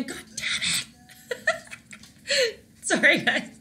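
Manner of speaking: laughing apology. Real, not acted. Laughing at own slip-up.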